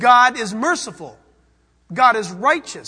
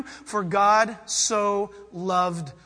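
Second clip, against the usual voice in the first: slow meditative reading; 5 semitones lower, much slower than usual. Shown as a demonstration. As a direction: savour each word slowly. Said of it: "To meditate on that would be like this".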